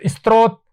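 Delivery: स्रोत as "is-trot"; wrong pronunciation. Shown as a demonstration. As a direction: show the mistake, स्रोत with an added i and t. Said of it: "नहीं, गलत".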